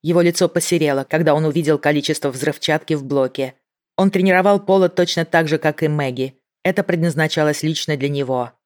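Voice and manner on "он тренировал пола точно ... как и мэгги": plain reading voice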